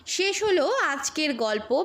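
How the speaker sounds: ordinary delivery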